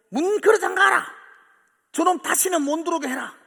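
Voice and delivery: an enraged father yelling to lock the door; father shouting at the mother to bar the door; angry father shouting. Retold or imitated. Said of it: "어머니한테 막 소리치는 거죠".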